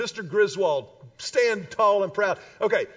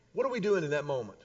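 usual speaking voice

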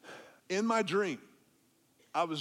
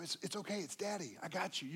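much softer, tender comfort; soft reassurance; 9 dB quieter than usual. Retold or imitated. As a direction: soothe a scared child. Father comforting frightened child.

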